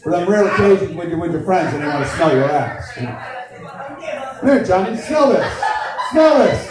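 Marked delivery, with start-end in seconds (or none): 4.4-6.7 mock eager offer. Acts friend offering scented butt.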